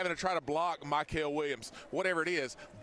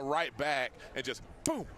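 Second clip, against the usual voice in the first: ordinary talk, nothing marked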